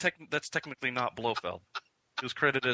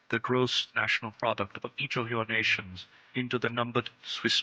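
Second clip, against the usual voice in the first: Blofeld; 5 semitones lower than usual. Pretend. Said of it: "my name is Ernst Stavro Blofeld".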